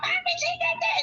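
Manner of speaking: put-on high whiny little kid voice; child's voice. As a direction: squeak it like a tattling child. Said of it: "And she came out".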